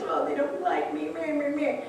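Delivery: whiny mock complaint. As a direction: imitate your own sulky self. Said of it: "I was all upset because".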